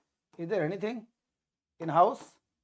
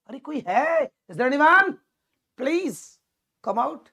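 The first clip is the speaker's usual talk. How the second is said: loud calls into an empty ruin, higher; calling out into ruins; 6 semitones higher, 6 dB louder, much slower than usual. Pretend. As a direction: shout into a deserted place, asking if anyone is there. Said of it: "वहां पर आप जा रहे हो तो क्या पूछ रहे हो". (सुनसान खंडहर में ज़ोर से पुकारना)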